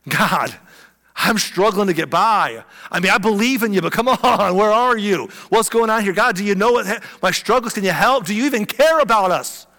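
a struggling fisherman begging God at night; desperate prayer. Acted. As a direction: cry out your frustration upward.

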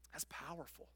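usual speaking voice

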